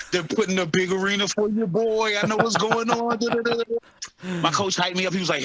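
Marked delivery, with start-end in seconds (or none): 0.1-4.4 excited. Retold or imitated. Fighter retelling how they tricked him, excited. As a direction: build up higher into a playful sing-song brag. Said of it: "They had me so excited".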